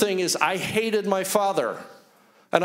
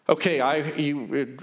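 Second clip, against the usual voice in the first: ordinary delivery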